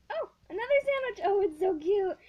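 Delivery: high, loud, fast cooing; delighted squeal. Real, not acted. Girl finds another sandwich squishy, squeals with delight. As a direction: coo over something cute.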